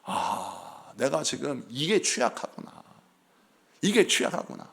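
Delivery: soft, hushed inner voice; quiet self-realization. Retold or imitated. Believer admitting a weakness to themselves, soft and hushed. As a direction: murmur it as a quiet discovery. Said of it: "우리는".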